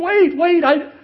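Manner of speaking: high, loud cry to stop; panicked shout. Retold or imitated. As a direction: act out sudden panic at takeoff. Retold friend panics at takeoff.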